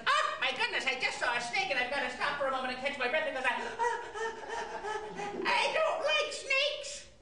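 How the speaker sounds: high, breathless squeaky panic; frightened fussy lady. Acted. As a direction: gasp it out like a fainting lady. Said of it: "saying things like".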